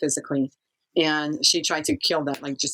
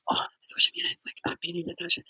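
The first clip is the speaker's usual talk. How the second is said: put-on hushed, breathy kid's whisper; retold whisper; 2 semitones higher, 4 dB quieter, faster than usual. Frightened kids whispering to the girl about the beating. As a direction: tell it under your breath, scared to be heard.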